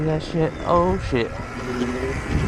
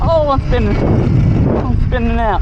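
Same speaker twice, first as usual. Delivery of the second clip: alarmed excitement; 6 semitones higher, 5 dB louder than usual. Real, not acted. Bike spinning out on snow.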